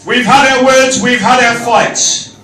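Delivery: reciting rhyming verse; poem recital. Acted. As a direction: read it like a poem.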